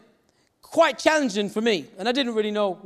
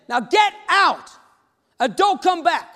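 higher, louder shout, drawn out; angry lady; 5 semitones higher, 5 dB louder, slower than usual. Retold or imitated. Angry lady shouting at a child by the door, furious. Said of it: "I saw this angry lady".

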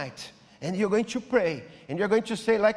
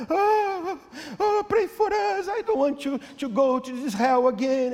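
much higher, pleading mock wail; imitating crying wife; 7 semitones higher than usual. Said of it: "the wife was crying".

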